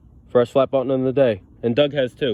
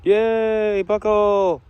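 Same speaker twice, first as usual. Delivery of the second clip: high, bright cheer of joy; excited squeal; 9 semitones higher, much slower than usual. Real, not acted. Detectorist cheering his buckle find, high excited squeal. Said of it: "I found a buckle".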